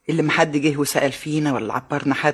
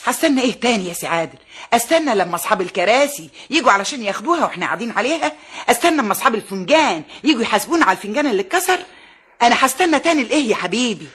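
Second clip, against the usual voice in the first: indignant rant; 5 semitones higher than usual. Acted. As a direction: pile up every grievance, faster at the end. Mother rants, refusing to wait. (أم غاضبة ترفض الانتظار، بصوت يعلو)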